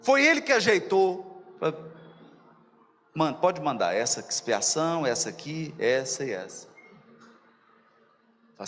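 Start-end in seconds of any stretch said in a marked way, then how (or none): 3.1-6.7 lightly voiced boss, low and casual; offhand order. Voicing heaven ordering trials sent down, lightly acted low offhand order. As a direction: hand out the orders in a low, unbothered voice.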